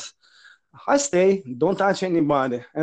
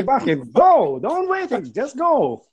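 excited cheering; 7 semitones higher, slower than usual. Pretend. Cheering the subway stranger on, high excited shout. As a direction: egg him on, rising high.

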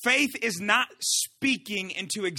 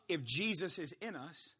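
usual speaking voice

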